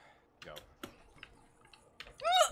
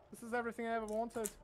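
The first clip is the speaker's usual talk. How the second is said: ordinary talk, nothing marked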